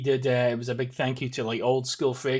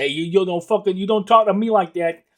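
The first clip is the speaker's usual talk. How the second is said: put-on a light, tough Boston accent, a bit louder; Boston accent impression; 5 semitones higher, 6 dB louder than usual. Imitating the film's Boston tough guy, louder Boston accent. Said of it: "that Boston accent".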